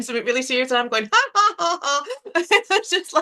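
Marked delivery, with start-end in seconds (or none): 1.1-3.1 high giggly ha ha ha; nervous laugh. Real, not acted. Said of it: "I'll nervous laugh".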